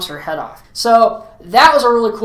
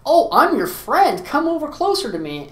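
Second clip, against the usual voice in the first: mock-friendly coaxing in a raised voice; luring clown; 6 semitones higher than usual. Clown calls the little girl closer, coaxing mock-friendly.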